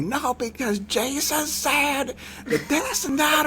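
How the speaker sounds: very high, drawn-out mock preachy rant. Imitating the guy down his Bible rabbit hole, mock ranting. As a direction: wail it slowly in a high mocking voice.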